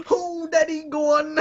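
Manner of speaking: high, whimpering fake labour wail; giving birth, mock. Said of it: "what I'm like when I'm giving birth".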